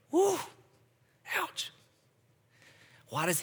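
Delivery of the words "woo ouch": high, startled "Woo", then a pained "ouch"; stung reaction. Retold or imitated. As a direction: react like the truth hit you hard. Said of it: "And you're gonna go".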